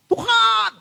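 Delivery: high, loud, desperate cry of "Tuhan!"; imploring shout to the Lord; crying out to God. Retold or imitated. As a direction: call out to God in anguish. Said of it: "kita teriak".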